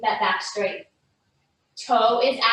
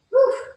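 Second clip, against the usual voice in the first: loud high whew of exertion; effortful exhale; 14 semitones higher, 6 dB louder than usual. Real, not acted. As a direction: blow out hard after the burn. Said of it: "my arms are burning".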